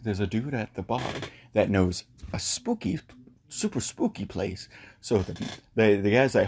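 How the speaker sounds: ordinary talk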